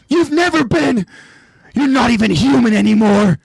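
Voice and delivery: very high, loud furious scream; screaming back. Acted. Aaron screaming at Rhina and Bertolt, very high and loud. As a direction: scream the hatred at them. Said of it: "Aaron screams back".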